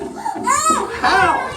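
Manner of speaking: excited demand. Acted. Teacher pressing children for answer.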